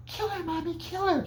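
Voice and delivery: put-on very high, soft child voice through the mother; dead son's voice. Mrs. Voorhees voicing her dead son in a child's high voice. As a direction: squeak it out like a ghostly little boy.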